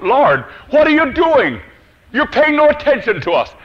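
high, loud protest to God; preacher's impassioned mock complaint. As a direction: cry out in acted reproach. Acted complaint of doubters to God.

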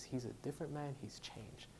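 very soft, fading almost to a whisper; hushed retelling of her words; quiet retold confession. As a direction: let the quote trail into a hush.

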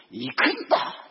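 loud barked order; bully the driver into going; rough command. Retold or imitated. Rude passenger ordering the taxi on, barked. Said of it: "もう乱暴な人だったんです".

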